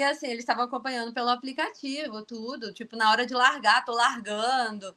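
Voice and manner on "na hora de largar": ordinary delivery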